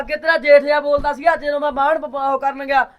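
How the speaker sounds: very high, loud enraged yell; furious shouting. Acted. Brother yelling at sister who threatens to leave, enraged. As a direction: scream her plan down.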